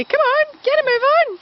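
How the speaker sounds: dog's voice. Retold or imitated. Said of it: "as if to say".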